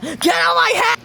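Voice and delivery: high-pitched strained scream; mock scream. Acting out the end of Joey's quote, mock scream. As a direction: scream it as a sudden outburst. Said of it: "She even copied the scream at the end".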